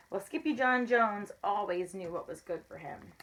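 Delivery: ordinary delivery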